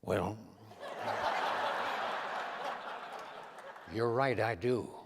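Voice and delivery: low Reagan voice breaking into a laugh; amused Reagan impression; Ronald Reagan's reply. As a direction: start with a low "Well", laugh, then agree. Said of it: "and he said".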